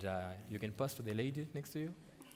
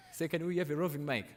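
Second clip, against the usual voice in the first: raised, higher call across the room; 9 semitones higher, 8 dB louder than usual. Real, not acted. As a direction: call out to the crew for a mic. Host calls out for a microphone.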